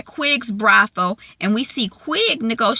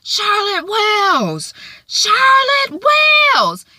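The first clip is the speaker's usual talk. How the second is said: high, loud drawn-out calling of a name; mama yelling; 6 dB louder, much slower than usual. Retold or imitated. Mother calling for her daughter, loud mock yell. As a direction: holler it across the street like a mother. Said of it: "we hear Margaret yelling".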